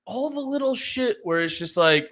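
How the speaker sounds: ordinary delivery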